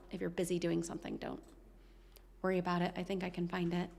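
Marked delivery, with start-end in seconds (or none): none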